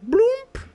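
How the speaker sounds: loud, very high mouth-made bloop; squeaky imitated notification noise; pretend sound alert. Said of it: "I don't have sound alerts. Just pretend".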